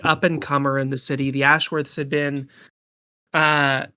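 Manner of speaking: usual speaking voice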